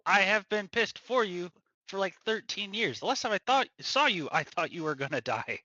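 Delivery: usual speaking voice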